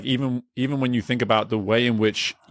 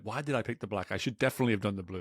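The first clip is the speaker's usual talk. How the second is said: quick, much softer self-doubting mutter; muttered second-guessing; 9 dB quieter, faster than usual. Retold or imitated. Doubting the pen choice to herself, in a quick soft mutter. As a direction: mutter fast to yourself, much softer, fretting over the choice. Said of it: "I'm like".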